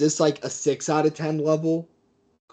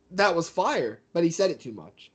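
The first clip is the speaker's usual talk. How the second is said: usual speaking voice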